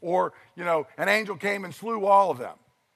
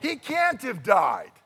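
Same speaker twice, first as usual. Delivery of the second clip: high protest, slowing on the last words; indignant kid; 6 semitones higher, 3 dB louder than usual. Retold or imitated. Preacher imitating a child watching Princess Bride, high and indignant. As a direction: play the outraged child who won't accept the plot. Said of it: "the kid's like".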